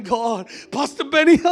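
loud wail breaking upward; hammed-up tearful cry to the pastor; mock crying. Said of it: "I'm crying".

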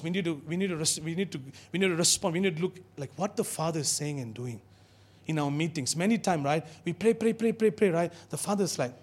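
ordinary talk, nothing marked

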